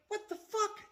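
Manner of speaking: squeaky high shocked what the fuck; mock outrage, voice cracking way up; high-pitched stunned squeal; disbelief. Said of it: "I'm like".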